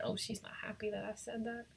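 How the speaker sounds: soft whispered aside; whispering. Real, not acted. Aside about upset baby.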